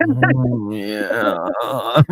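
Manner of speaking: drawn-out, loud mock-sensual croon into the mic; mock ASMR performer. Podcaster parodying someone rubbing a mustache on the microphone, hammed-up. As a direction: ham up a soothing ASMR "oh yeah". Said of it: "rub their mustache on the microphone and go".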